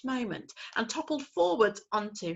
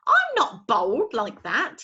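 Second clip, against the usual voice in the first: slow, louder huffy owlet; indignant; 5 semitones higher, 8 dB louder, slower than usual. Acted. Owlet protesting his picture. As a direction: protest the drawing. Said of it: "he said indignantly".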